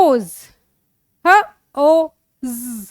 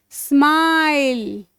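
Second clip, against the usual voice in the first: slow blending; much slower than usual. Shown as a demonstration. Teacher blends sounds into word.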